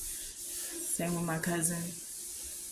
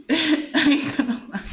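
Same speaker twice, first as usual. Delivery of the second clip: laughing; 8 semitones higher, 6 dB louder than usual. Real, not acted. Laughing at her own story.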